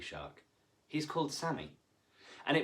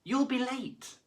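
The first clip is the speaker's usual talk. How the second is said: mother calling out; 13 semitones higher, 4 dB louder than usual. A narrator's reading. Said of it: "his mother called".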